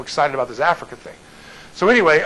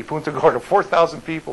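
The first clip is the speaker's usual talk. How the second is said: ordinary talk, nothing marked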